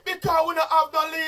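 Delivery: loud frustrated outcry; outburst. Real, not acted. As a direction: burst out in frustration. Outburst about visa unfairness.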